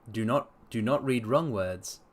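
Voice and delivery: ordinary delivery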